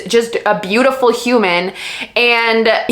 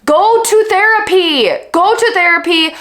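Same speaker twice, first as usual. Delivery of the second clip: exasperated advice; 6 semitones higher than usual. Real, not acted. Telling a character off.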